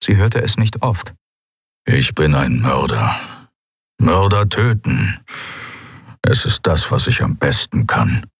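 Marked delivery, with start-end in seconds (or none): 1.9-8.3 mostly low, measured grumble; grumbling man. A narrator's reading. Kero admits to Endriel he is a murderer, grumbling. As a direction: voice a brooding killer, low. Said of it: "brummte er".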